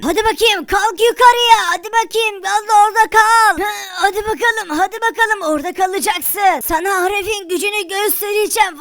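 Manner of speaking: hammed-up magician straining; spell-casting wizard. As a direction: push the magic up with effort.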